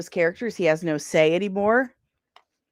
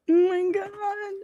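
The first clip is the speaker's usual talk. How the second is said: mock wail; 10 semitones higher, slower than usual. Real, not acted. Laughing cry over friend.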